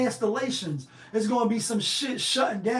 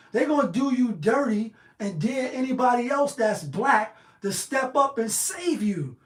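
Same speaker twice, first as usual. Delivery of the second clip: ordinary delivery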